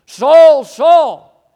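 high, booming cry of the name twice; loud calling out. Acted. Preacher voicing Jesus calling to Saul, loud and high. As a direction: call out each name at full force.

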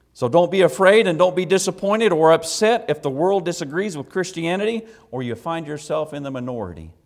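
forceful preaching. Real, not acted. Preacher urging congregation not to be afraid, forceful preaching. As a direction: urge the congregation on from the pulpit.

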